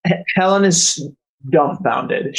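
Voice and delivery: normal talking voice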